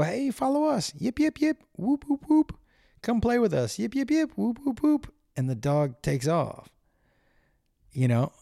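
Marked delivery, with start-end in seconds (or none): 0.0-5.1 high yipping coyote lure; high, playful yips luring the dog away; coyote pack voice. Retold or imitated. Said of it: "is they go".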